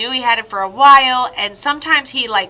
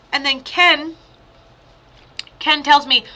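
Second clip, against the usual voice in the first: ordinary delivery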